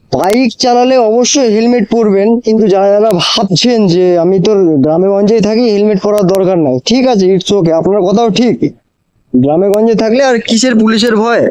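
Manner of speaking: usual speaking voice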